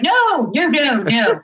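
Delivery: everybody refuses dessert at once; mortified guests. Retold or imitated.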